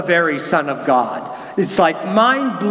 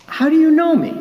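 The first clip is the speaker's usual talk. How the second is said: higher, startled asking; surprised question; 9 semitones higher, 3 dB louder, faster than usual. Retold or imitated. Nathanael surprised, asking Jesus how he knows him. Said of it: "Surprised himself".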